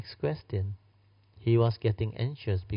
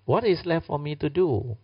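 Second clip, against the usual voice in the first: distraught Ananda; 8 semitones higher than usual. Acted. Grieving Ananda protests to Anuruddha, high and desperate. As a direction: voice rising in frantic despair. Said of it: "Ananda said".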